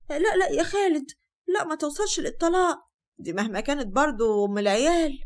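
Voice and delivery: high, put-on plea with a laugh slipping through; fake pleading. Sister-in-law faking concern as the husband divorces his wife, mock pleading. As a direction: pretend to defend her, insincerely. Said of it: "هند كانت بتمثل وقالت".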